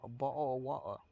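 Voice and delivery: "bottle of water" said the British way, with the t's dropped as "bo'le" and "wa'er"; British accent. Shown as a demonstration. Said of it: "It's more the".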